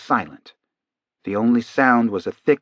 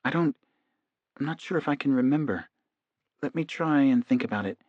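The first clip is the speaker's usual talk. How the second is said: struggling to remember; 3 semitones higher, 8 dB quieter than usual. A narrator's reading. Hypnotized man trying to remember, softly struggling. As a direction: grope softly for the memory. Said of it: "Gary appeared to be struggling a little".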